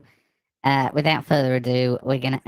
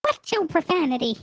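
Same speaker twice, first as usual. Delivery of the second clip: chipmunk voice. Acted. Voice changer.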